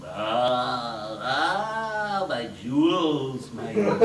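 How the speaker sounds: lightly voiced low, slow gurgle of satisfaction; gloating dwarf. Dwarf strokes his treasure bags, low satisfied gloat. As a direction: croon over the treasure. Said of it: "low gurgling sound of deep guttural satisfaction".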